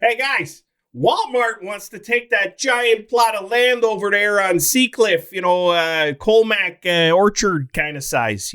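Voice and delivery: high, slow put-on small-town accent; high drawn-out mock folksy accent; Walmart accent. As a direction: play a chirpy store manager pitching a new plot. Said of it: "I don't know why I did it in that accent".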